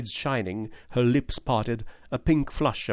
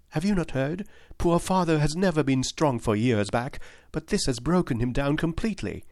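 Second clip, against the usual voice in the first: put-on higher, pleading young lady's voice; earnest young woman; 6 semitones higher than usual. Miss Turner insisting to Holmes that James is innocent, higher earnest voice. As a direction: plead for James with eager conviction.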